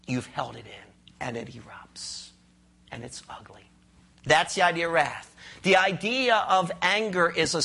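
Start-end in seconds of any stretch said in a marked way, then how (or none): 0.1-3.7 low, hushed whisper, much softer. Acted. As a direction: breathe it out like a secret. Preacher describes wrath erupting.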